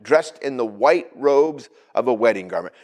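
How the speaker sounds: ordinary talk, nothing marked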